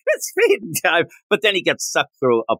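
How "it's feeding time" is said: put-on high, sing-song baby talk to pets; high cooing voice. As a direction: coo like talking to a puppy. Superman cooing at zoo animals.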